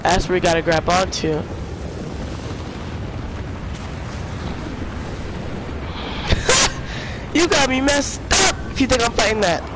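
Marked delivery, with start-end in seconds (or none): 1.5-7.3 wheezing high-pitched laughter; airy laugh with no voice; high breathless laugh. Real, not acted. Laughing at the fight. 7.3-9.6 fast, high, laughing refusal; excited refusal. Real, not acted. Refusing to fight the monster.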